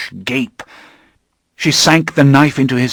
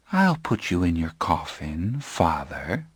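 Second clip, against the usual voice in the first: softer, soothing and menacing; 3 semitones lower, 9 dB quieter than usual. Acted. Claudia soothes her dying father, softly. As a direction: lull him like a child. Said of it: "as though she were soothing him".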